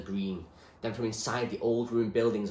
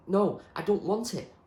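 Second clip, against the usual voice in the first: higher, pleading refusal; protesting; 12 semitones higher, 5 dB louder than usual. Acted. Zoe refuses the weapon, protesting higher. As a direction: push the pike away in protest. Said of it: "she protested".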